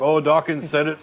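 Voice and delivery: ordinary delivery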